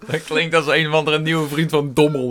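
loud laughing chatter. Real, not acted. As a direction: laugh through the joke. Jokes about silly word.

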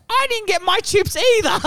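high, whining complaint; whiny friend. Retold or imitated. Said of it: "Fergus is like".

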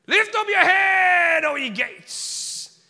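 high, booming, drawn-out stage declamation; Shakespearean actor. Retold or imitated. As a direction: bellow it slowly like a hammy Shakespearean. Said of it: "He's like a Shakespearean actor now".

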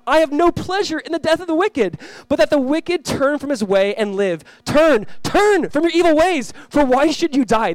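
high, loud, slow proclamation; preacher crying out God's appeal; impassioned pleading. Acted. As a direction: plead urgently, stretching the key words. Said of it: "Do you not hear God pleading?".

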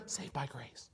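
unvoiced whisper, dropping away on saved by grace. Preacher showing the forgotten grace part, whispered. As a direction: let sinner, saved by grace fade to a whisper.

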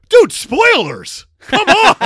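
whiny outraged commenter, high and loud; mock angry fan. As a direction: yell like an offended fan.

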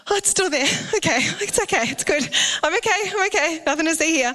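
the little son reassuring himself; imitating relieved boy. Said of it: "he'd wake up and go".

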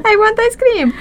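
put-on high, pleading little-kid voice; pretend child. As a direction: whine playfully like a child wanting a treat. Playing the child answering the threat.

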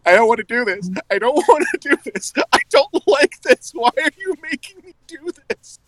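high, half-laughing plea; distressed pleading. Retold or imitated. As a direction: beg not to fight her. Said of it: "I was like".

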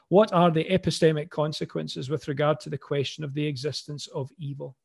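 usual speaking voice

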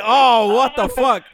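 loud, startled oh what the; shocked outburst. Retold or imitated. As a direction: blurt it out in disbelief. Shocked as cars arrive.

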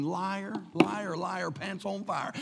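low, soft sing-song taunt under the breath; muttered disbelief. Acted. Dieter thinking back at a weight-loss commercial, muttered taunt. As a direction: drop it to a private mutter. Private reaction to diet commercials.

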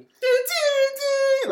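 high loud sung doo doo; mock trumpet fanfare. Copying the meme intro music, loud sung fanfare. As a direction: belt out the fanfare.

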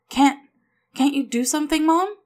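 put-on high, pleading child voice; urgent plea climbing in pitch; desperate hope. A narrator's reading. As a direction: plead upward, halting on the first word. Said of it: "hoping for a miracle".

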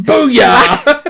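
triumphant booyah. Real, not acted. Triumphant exclamation.

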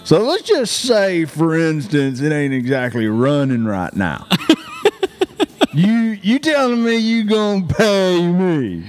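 put-on country boy voice. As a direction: ham up a country boy, laughing through it. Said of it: "I'm gonna be like".

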